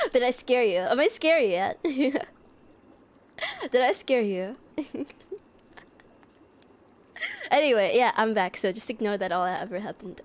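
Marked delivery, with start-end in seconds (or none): none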